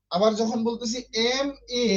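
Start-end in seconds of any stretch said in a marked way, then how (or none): 1.3-1.9 letter names. Shown as a demonstration. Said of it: "তখন আমরা কি উচ্চারণ করি?".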